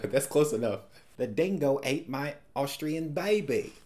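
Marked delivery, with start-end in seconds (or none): 1.2-3.7 a jokey put-on Australian accent; mock Australian accent. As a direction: toss it off in a hammy Australian accent. Riffing on movie's Australian accent.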